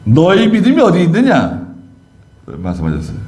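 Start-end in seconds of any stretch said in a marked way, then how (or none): 0.1-1.6 "너희 믿음이 어디 있느냐" asked higher, with a push on 믿음이; questioning reproach. Acted. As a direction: reproach the disciples' faith. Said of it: "또 다른 성경에서는". (제자들의 믿음을 나무라는 높은 질문)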